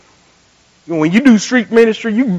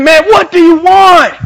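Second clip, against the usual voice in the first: high, loud, stretched-out irritated demand; acted angry shout; 11 semitones higher, 10 dB louder, slower than usual. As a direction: imitate a big man yelling in annoyance. Acts out the annoyed man shouting.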